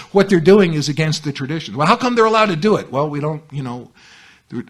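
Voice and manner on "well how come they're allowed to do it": high, loud, quick protest; indignant objection. Retold or imitated. Newcomer objecting to what another group does, indignant protest.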